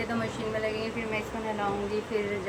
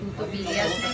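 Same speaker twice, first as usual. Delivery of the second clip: usual speaking voice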